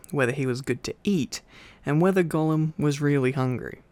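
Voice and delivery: usual speaking voice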